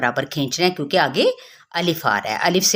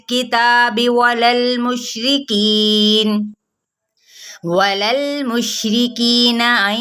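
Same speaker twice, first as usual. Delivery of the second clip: slow, melodic tajweed chant, higher pitch; Quran recitation; 4 semitones higher, much slower than usual. Shown as a demonstration. Teacher reciting verses for students, slow melodic chant. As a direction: recite each verse slowly with drawn-out vowels.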